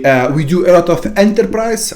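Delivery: playful fake French accent on corporate talk. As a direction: slip into a hammed-up French accent for the buzzword. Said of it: "a French accent there".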